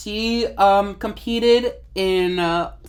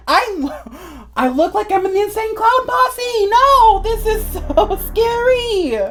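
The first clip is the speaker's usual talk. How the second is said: shocked horror; 11 semitones higher than usual. Real, not acted. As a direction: react in laughing horror. Shocked at clown makeup.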